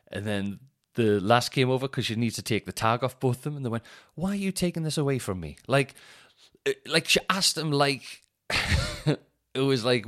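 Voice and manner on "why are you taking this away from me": high, whiny posh shopper; lightly mocking a posh shopper's complaint; mock posh whine. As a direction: play the put-out posh customer. Posh customer whining at checkout.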